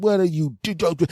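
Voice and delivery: put-on high, stammering young kid; a kid too shocked to get words out; flustered stammer. Boyfriend catching them in bed, high flustered stammer.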